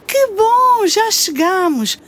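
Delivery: high delighted cry; excited. Acted. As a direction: burst out with glee. Arrival after flight.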